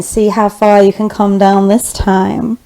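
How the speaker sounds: ordinary talk, nothing marked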